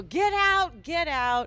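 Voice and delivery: high mock yell of get out; retold yelling. Friend retelling the fight, mock yelling. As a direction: shout it like she's chasing him out.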